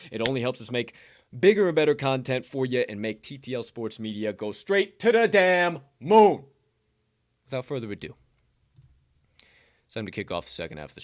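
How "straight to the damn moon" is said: hyped finish. Acted. Host hyping subscribe plug.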